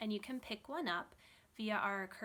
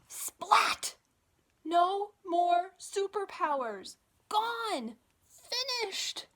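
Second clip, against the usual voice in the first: higher, louder cries of splat and gone; dramatic exclamation; 10 semitones higher, 11 dB louder, much slower than usual. Acted. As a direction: slam each word out like the big crash moment. Dramatic crash in story.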